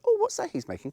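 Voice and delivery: very high mock-curious voice; imitating a curious viewer. Vlogger mocking a viewer who wonders what he cooks, high squeak. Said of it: "That you've seen when you've gone".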